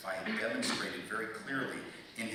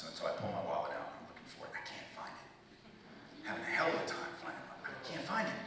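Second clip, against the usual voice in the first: normal talking voice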